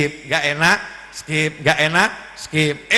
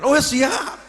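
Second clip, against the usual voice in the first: higher, quick ready answer; eager servant; 9 semitones higher, 4 dB louder than usual. Acted. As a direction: jump to attention. (abdi yang sigap menjawab siap)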